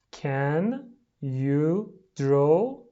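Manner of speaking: very slow, each word separate and rising. Shown as a demonstration. Teacher pronouncing for pupils at home, word by word. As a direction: stretch out every word with a gap after it.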